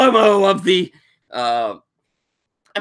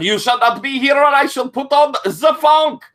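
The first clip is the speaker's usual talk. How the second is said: high, ringing, regal decree; Rami's grand warning; 10 semitones higher, 4 dB louder than usual. Acted. Rami threatens the Germans with funk, high regal decree. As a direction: proclaim it like a fabulous queen.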